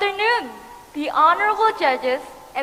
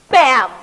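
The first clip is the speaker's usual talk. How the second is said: loud bam; 8 dB louder than usual. A narrator's reading. Oven noise in story.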